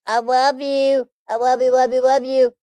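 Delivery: cutesy cat voice greeting viewers; voicing the cat.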